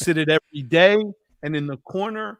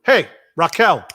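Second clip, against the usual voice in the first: raised, sharp "Hey, Raquel" with a finger snap; mock scold across the room; scolding call; 3 semitones higher, 6 dB louder than usual. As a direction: call her out loudly.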